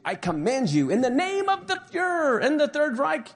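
an officer screaming eat; commanding shout. Acted. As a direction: pitch climbing to a shrill final command. SS officer ordering prisoners.

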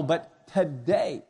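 "today" drawn out slow and weighted; "but today" slow and insistent; stress on today. Shown as a demonstration. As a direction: lean hard on "today". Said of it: "very emphatic".

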